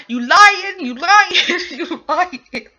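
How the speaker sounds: high, loud, laughing outburst; laughing accusation. Real, not acted. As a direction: call out a liar while cracking up. Mock accusation, dissolving into laughter.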